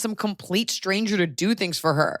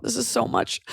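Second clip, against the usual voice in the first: normal talking voice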